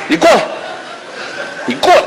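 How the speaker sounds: angry summons. Acted. Betrayed husband calls his friend over, angrily. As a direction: order him over, ready to hit him.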